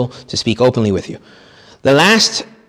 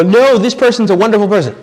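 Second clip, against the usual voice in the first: higher, louder mock gushing praise; gushing parishioner; 9 semitones higher, 4 dB louder than usual. People insisting to the priest a person is wonderful. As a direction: play the eager admirer.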